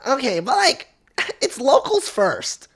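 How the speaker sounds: very high, slow squeaky caricature; mocking lady voice. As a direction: imitate her in drawn-out falsetto. Mimics lady's locals remark.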